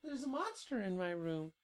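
high, soft mock little-girl voice; too-happy little girl. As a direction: imitate a child calmly faking a scare. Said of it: "she sounded too happy and calm to be scared".

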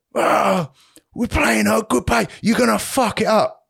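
high, put-on cocky geezer voice; squeaky mimic of a mouthy stranger; mocking the intruder. Golfer mimics the intruder butting in at the tee, high mock voice. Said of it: "He's like".

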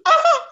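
very high, loud mock shriek; sudden falsetto scream of fright; panicked yelp. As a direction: imitate a big guy's terrified squeal. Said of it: "and Rhino goes".

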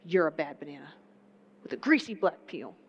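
bad banana said low and quiet; low, soft disgusted delivery. Shown as a demonstration. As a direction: drop the voice low and let it sneer softly. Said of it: "Or I can say".

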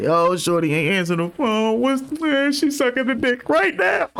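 1 mock panicked high voice. Retold panicked thoughts about girlfriend.

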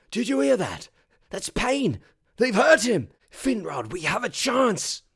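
high, urgent shouting; excited hope. Acted. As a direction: burst out with sudden battlefield hope. Excited hope as enemy screams.

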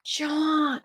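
high, drawn-out call of the name; mock yell from a mother; imitated yell. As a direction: re-enact yelling for your son, not at full volume. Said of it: "I remember yelling".

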